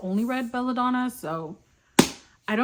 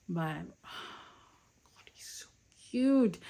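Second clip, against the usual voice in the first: whispered gushing; 5 dB quieter, much slower than usual. Real, not acted. Gushing over her dog.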